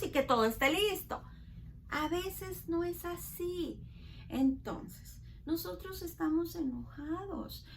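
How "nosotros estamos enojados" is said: ordinary talk, nothing marked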